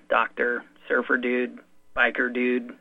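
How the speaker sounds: ordinary delivery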